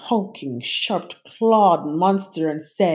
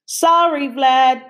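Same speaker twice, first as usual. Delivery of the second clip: higher, louder apologetic call; 9 semitones higher, 6 dB louder than usual. A narrator's reading. Narrator apologising to the departing monster, called. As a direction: call after him as he leaves. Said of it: "I called".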